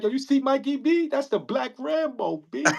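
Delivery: awed bragging. Retold or imitated. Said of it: "I'm like".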